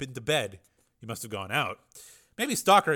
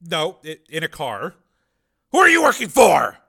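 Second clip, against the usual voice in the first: loud, higher-pitched demands; sharp interrogation; 7 semitones higher, 14 dB louder than usual. Acted. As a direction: fire the questions hard at a captive. Armed interrogation of a captive.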